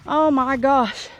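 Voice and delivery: loud, slow alarmed cry; startled by a spider. Real, not acted. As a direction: jump back from a spider. Big spider in the haystack.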